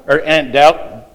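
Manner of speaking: ordinary delivery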